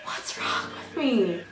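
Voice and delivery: breathless whisper to herself; whispered, overwhelmed by tears. Real, not acted. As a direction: hush it as if ashamed. Overwhelmed after the sad clip.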